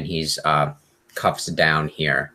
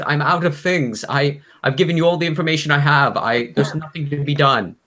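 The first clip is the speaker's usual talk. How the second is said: high, pleading captive's voice; squirming prisoner; 9 semitones higher, 3 dB louder than usual. Acted. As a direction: plead desperately, pitched well up and stammering. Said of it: "balding man start to squirm and goes".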